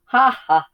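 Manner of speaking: high, loud bleating ha ha; bleating laugh. A narrator's reading. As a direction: bleat the laugh like a cheeky lamb. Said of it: "bleated the big lamb".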